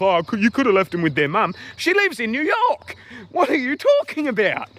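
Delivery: high incredulous voice, rising to outrage; mock her suggestion in disbelief. Venting about the agent, high incredulous mockery.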